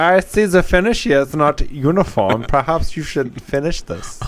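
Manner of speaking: a picky German inspecting workmanship; hammed-up German accent, slowing at the end. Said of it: "He's good at accents".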